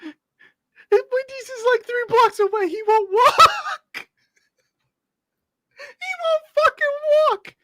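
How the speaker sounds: very high, squealing incredulity; hysterical laughing outrage. Real, not acted. Co-host ranting that Phil won't walk to Wendy's, hysterically amused. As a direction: shriek it through laughter, drawing out the end.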